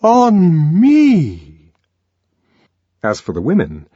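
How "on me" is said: high, loud, slow offended complaint; indignant songster. Acted. The arch songster complaining about the Savage, loud and indignant. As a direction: protest the insult to yourself. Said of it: "kept repeating".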